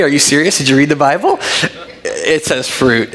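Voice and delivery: ordinary delivery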